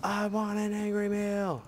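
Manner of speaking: high cartoonish whiny voice; silly voice. Gamer joking to himself in a squeaky cartoon voice. Silly voice joke about meals.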